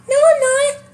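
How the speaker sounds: imitating him an octave up; high-pitched denial. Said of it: "high-pitched in his voice".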